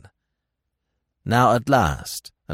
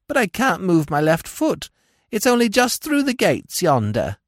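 whined; 11 semitones higher, 3 dB louder than usual. A narrator's reading. Old woman whines to Smith about her fall. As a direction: whimper like a hurt old hag.

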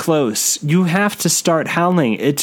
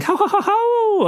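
high hooting werewolf ho-ho; bone-chilling laugh. A narrator's reading. Narrator voicing a werewolf's eerie laugh. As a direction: cackle it out like a werewolf. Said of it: "a bone-chilling".

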